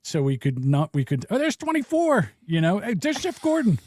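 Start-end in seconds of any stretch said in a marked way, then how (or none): 1.3-2.3 high-pitched gleeful call-out; playful high exclamation; mock excited spotting. Acting out spotting numbered cockroaches. 2.8-3.8 high-pitched gleeful call-out; playful high exclamation; mock excited spotting. Acting out spotting numbered cockroaches.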